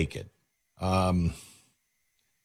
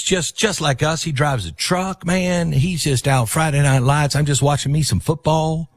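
higher-pitched put-on Texas twang; 6 semitones higher than usual. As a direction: imitate a phony good old Texas boy. Mocks Jimmy as put-on Texan.

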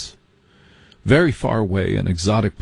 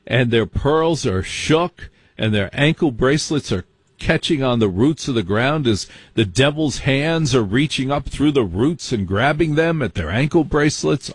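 normal talking voice

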